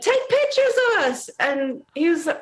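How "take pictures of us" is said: mock excited demand. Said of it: "thrust their phones at Nick and went".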